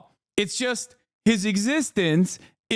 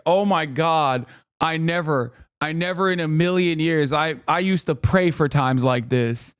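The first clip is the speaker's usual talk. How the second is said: usual speaking voice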